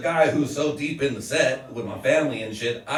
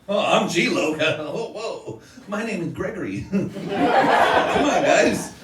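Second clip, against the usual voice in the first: higher-pitched, laughing, mock-timid protest; out-of-place innocent; 6 semitones higher than usual. Gregory telling gang members he graduated high school, mock timid. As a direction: plead innocence in a raised goofy voice.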